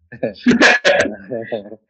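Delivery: loud, deep laugh at his own joke; hearty laugh. Real, not acted. Therapist laughing at his joke about sending the child out. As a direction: laugh out at the punchline.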